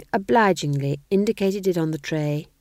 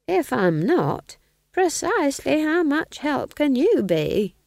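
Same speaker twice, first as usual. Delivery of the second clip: put-on much higher, slow dry old witch; dry retort; 9 semitones higher, 3 dB louder, slower than usual. A narrator's reading. Nanny Og brushing off the king's worry, high and slow. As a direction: tease him with a dry question. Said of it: "said Nanny".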